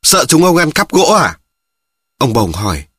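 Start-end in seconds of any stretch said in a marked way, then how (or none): 0.0-1.4 snapped rebuke. Acted. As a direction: bark it out like a gruff, irritated carpenter. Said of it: "Ông thợ mộc quát".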